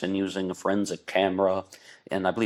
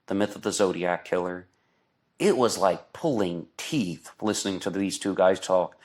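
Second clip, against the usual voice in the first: usual speaking voice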